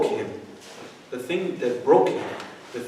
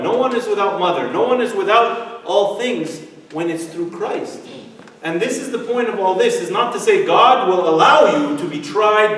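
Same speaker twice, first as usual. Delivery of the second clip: loud, rising sermon refrain; preaching with force; 2 semitones higher, 4 dB louder than usual. Acted. Preacher urging the youth group, full-force preaching.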